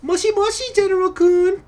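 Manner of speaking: put-on very high squeaky anime girl. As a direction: chirp it out like a cute anime heroine. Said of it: "then the officer speaks".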